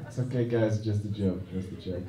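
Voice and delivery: normal talking voice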